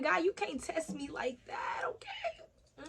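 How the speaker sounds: high, soft, drawn-out squeak at the end; playful scolding. Real, not acted. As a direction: scold God jokingly, voice climbing high and small. Mock scolding of God, half laughing.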